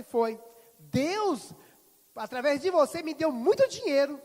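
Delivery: high and loud, proclaiming it; triumphant. Retold or imitated. Pastor proclaiming God's gift to the businesswoman. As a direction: announce the blessing with full joy.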